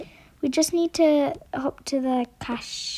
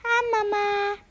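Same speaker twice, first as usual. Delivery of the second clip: put-on child voice; 7 semitones higher than usual. Child figure greets mother.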